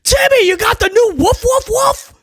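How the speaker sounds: very high, squeaky schoolkid; hammed-up child asking a friend; mock kid voice. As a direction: play an excited little kid showing off.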